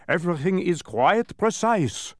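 high, loud, precise reproof; admonishing. A narrator's reading. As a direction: lay down the rule emphatically. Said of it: "admonished the dodecahedron from his admonishing face".

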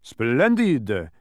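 loud, exclaimed praise; delighted cry. A narrator's reading. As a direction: cry it out with approval. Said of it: "cried the dodecahedron".

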